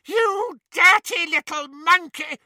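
high, loud furious bawl. Acted. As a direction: bawl it in rage. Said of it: "bawled Bellatrix".

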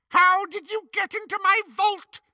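high, shrill witch screech; screaming witch. Acted. Bellatrix screams at Hermione about her vault, high witch screech. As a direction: screech the question like a mad witch. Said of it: "they heard Bellatrix scream".

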